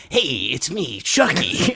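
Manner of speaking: put-on scary childlike doll voice, high and loud; menacing but childlike, punching up at the end; imitated creepy killer-doll voice; Chucky. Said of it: "scarier voice, kind of a more menacing but childlike".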